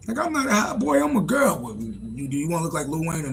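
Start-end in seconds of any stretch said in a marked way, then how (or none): 0.1-1.6 daughter voice. Retold or imitated. Daughter replies.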